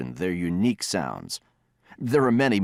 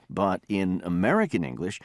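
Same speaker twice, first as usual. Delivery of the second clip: ordinary delivery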